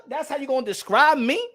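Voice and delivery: usual speaking voice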